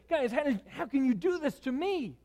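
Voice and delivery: high, whiny mock complaint; whiny self-pity. A leader whining at rebellious people, in high mock self-pity. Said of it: "righteous anger that isn't saying".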